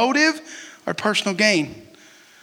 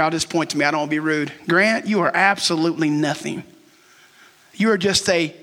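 normal talking voice

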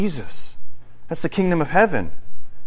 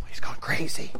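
hushed, voiceless aside; scandalised stage whisper; whispered gossip; 9 dB quieter than usual. Acted. Bystanders gossip about the man.

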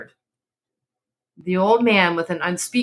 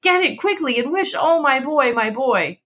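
desperate pleading; 7 semitones higher than usual. A narrator's reading. Mother pleading for son.

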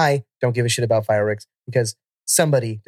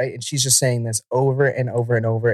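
normal talking voice

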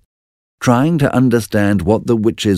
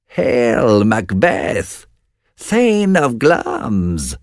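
high, slow eerie witch call; first witch crying a prophecy, high and drawn out; 5 semitones higher, much slower than usual. Acted. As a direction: hail him from the mist like a witch. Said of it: "cried the first witch".

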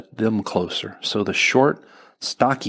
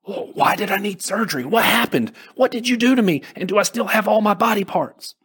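high, panicked, stammering start; scared patient pleading with the doctor; frightened questions; 12 semitones higher than usual. Acted. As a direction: voice leaping up in alarm at waking. Patient waking after surgery, frightened.

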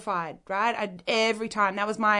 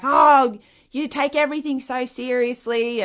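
loud, higher mock scoff; dismissive dad; 6 semitones higher, 8 dB louder than usual. Dad brushes off his upset daughter, mock exasperated. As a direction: imitate a dad loudly brushing off her upset.

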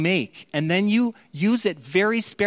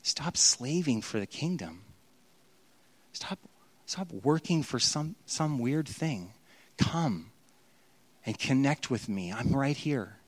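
much softer, tender invitation; gentle plea; 3 semitones lower, 8 dB quieter than usual. Acted. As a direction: quietly urge them to stop and rest. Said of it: "where Jesus says".

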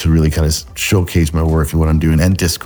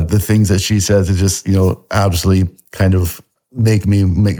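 normal talking voice

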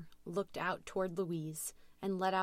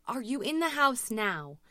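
higher, louder pressing question; urgent dispatcher; 6 semitones higher, 6 dB louder than usual. A narrator's reading. Dispatcher checking where Louise is, urgent. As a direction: press the caller for her location.

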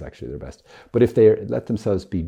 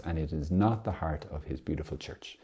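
ordinary talk

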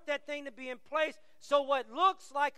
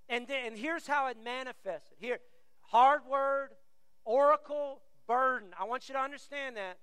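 usual speaking voice

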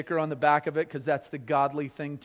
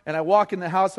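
usual speaking voice

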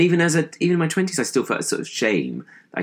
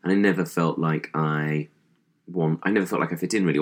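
ordinary talk, nothing marked

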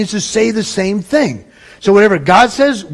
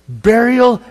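ordinary delivery

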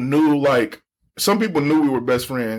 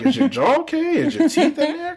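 mock concern; 14 semitones higher than usual. Mock concerned classmates asking.

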